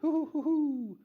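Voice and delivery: high, loud owl hooting; teacher shows kids the owl call. Retold or imitated. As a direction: hoot like a barred owl. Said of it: "but in an owl noise".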